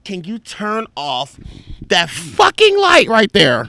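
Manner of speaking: slow, high-pitched exasperated demand; mock irritated woman's voice; mimicked annoyed friend. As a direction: drag it out high, imitating a fed-up sleeper. Acts annoyed friend demanding darkness.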